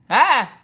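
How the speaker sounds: short, loud, high yelp; mock scream. Looking at the butterfly picture, playful startled scream.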